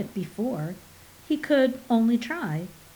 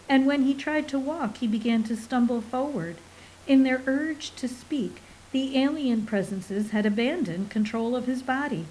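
ordinary delivery

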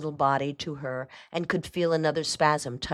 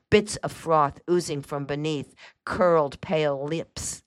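plain reading voice

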